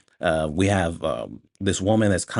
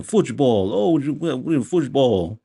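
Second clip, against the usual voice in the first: mocking Sacha Baron Cohen's bad accent, pitched up; high, put-on foreign accent; 5 semitones higher, slower than usual. Reviewer mimicking the drug lord's bad accent. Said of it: "The way he's talking".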